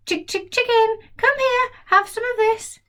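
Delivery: high, sing-song chick call; coaxing the hen. Acted. George coaxing a hen to taste the medicine. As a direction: lure the hen over sweetly. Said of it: "George coaxes the hen".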